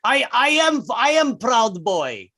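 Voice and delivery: Russian insurgent badly posing as a Southerner; fake Southern accent, Russian underneath. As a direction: protest innocence in a put-on Russian accent. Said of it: "they would try to fake one".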